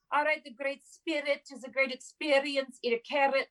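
put-on old timey mid-Atlantic accent, high; glamorous old Hollywood mid-Atlantic accent. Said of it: "with that mid-Atlantic accent, like the old timey".

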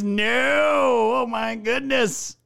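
stunned, stretched-out "no, oh my goodness"; shocked disbelief. Real, not acted. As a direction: drag it out in disbelief. Reacting to monkey crash headline.

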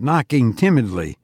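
ordinary delivery, nothing marked

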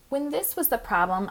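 ordinary delivery

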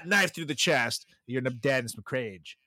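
normal talking voice

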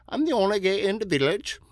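high, quick mock-Welsh comic voice; Little Britain impression. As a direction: imitate the sketch character in a Welsh accent. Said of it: "you know, Little Britain? And he's like".

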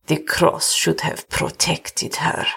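put-on slow, solemn old servant; deliberate and grave, in an older man's voice; grim. A narrator's reading. Old servant on the failed cross, grim. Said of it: "said Karel grimly".

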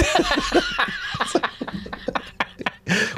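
high unvoiced wheezing laugh; real high wheeze-laugh; breathy laugh. Real, not acted. Laughing at own story.

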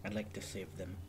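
quiet, guarded, somber; holding back painful memories. Acted. Character declines to say more.

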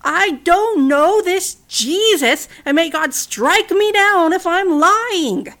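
loud, high, very slow shout; shouted denial. Acted. Peter shouting at the accuser, slow loud desperate oath. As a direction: roar the oath as if your life depends on it. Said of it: "And Peter shouted".

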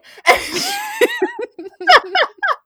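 high shrieking laugh; burst of laughter. Real, not acted. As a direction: squeal with laughter. Laughing at own story.

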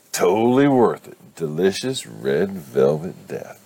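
loud, high start, then slow savoring; gleeful. Acted. Cupcake thief savoring red velvet death, gleeful. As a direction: relish each word, slowing right down.

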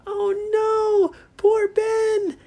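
very high, drawn-out dismay; the kids crying out over Ben, high and slow. Acted. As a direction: wail it, high and worried. Kids see Ben sinking.

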